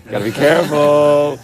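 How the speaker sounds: playful slow "careful" to friends; mock cautious. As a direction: ham up the caution as a bit. Wary joke before a careful move.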